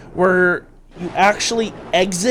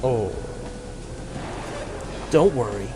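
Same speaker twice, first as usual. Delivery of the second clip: lightly voiced villain; 3 semitones lower than usual. Villain telling the kids to forget, low and menacing.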